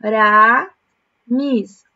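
Aramis stretched slowly and stressed, to be circled; slow, careful Aramis for the pupils; Aramis drawn out. Shown as a demonstration. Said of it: "ó a palavra Aramis". (Aramis bem devagar e destacado)

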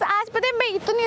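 normal talking voice

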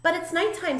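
high little bat voice; squeaked protest. A narrator's reading. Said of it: "Stella Luna squeaked".

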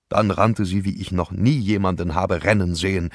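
ordinary delivery, nothing marked

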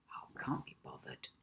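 breathy whispered shrug; low whispered reply to a friend. Acted. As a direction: whisper it, lazily unbothered.

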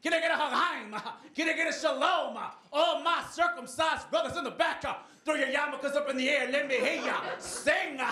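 high, shouted gospel-preacher call; hammed-up preacher rousing the pews. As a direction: whip the congregation up at full volume.

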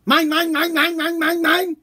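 put-on high, whining little-kid voice; high mock toddler whine; whiny toddler. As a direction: mimic a child grabbing toys.

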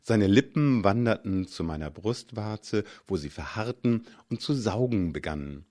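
usual speaking voice

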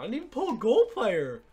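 high, loud cry of disbelief; outraged yelling. Real, not acted. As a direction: yell it in exasperated disbelief. Outraged at getting no gold player.

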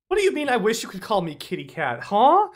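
squeaky high disbelief; baffled outraged what; incredulous. Real, not acted. Incredulous reaction to chat.

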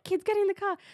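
high-pitched call to the kids, acted out; urgent retold warning. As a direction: retell hurrying the kids away from danger. Retelling urgent warning to children.

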